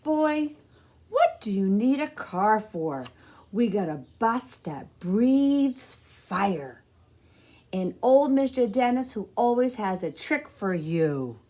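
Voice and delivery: a hint of a no-nonsense grandmother; lightly voiced grandma. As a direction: set the boy straight, then brighten. Nana answering CJ.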